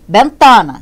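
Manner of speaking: Bentana, loud, weight on middle TA; stress on penultimate syllable. Shown as a demonstration. Teacher locating the tonic syllable, Bentana stressed on TA. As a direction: punch the TA of Bentana. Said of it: "sílaba tônica".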